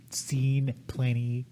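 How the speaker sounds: slow, low, quiet relish; mock solemn boast. Answering crude question as a man, slow mock-solemn boast.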